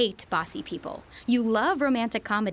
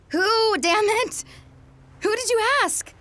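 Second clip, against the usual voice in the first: tearful outburst; 12 semitones higher, 4 dB louder than usual. Acted. Demanding to know who helped.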